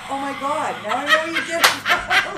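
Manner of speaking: loud, high, cracking up; laughing mock shock. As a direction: exclaim it through laughter. Laughing at boyfriend tease.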